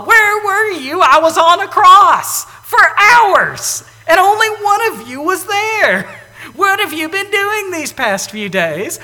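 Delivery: high, loud, frustrated scolding; exasperated Jesus scolding the disciples who abandoned him; exasperated rant. Pretend. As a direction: scold the disciples like a fed-up teacher. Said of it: "I might start with".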